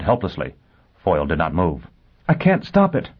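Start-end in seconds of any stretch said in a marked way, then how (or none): 2.3-3.1 high, gasped alarm; gasping. A narrator's reading. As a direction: gasp it out helplessly, pitched well up. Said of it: "she gasped at last".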